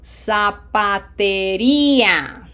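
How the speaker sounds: "Zapatería" slowly by syllables, Z as S, long stressed "-rí-"; slow demonstration. Shown as a demonstration. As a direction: stretch "Zapatería" out, pushing hard on the í. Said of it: "Say it slowly like I do".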